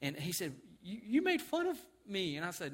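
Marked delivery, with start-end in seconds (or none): 0.8-2.4 higher, slow wounded child imitation; drawn-out mock tearful complaint; crying child. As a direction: imitate a hurt boy accusing Dad. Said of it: "he's crying, he's shaking a little bit".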